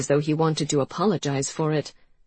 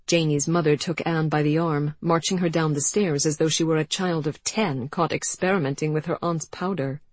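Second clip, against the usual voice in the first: usual speaking voice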